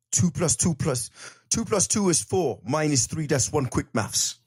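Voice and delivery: high, punchy London road-man rap voice. Retold or imitated. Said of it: "that's some [name] lyrics for you".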